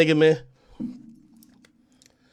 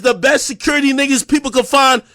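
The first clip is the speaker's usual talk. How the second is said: ordinary talk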